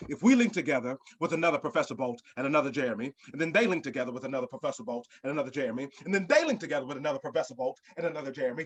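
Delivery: fast, rushed repetition that keeps going; speeding patter. Acted. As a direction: gabble through the list without breath. Escalating repeated jargon.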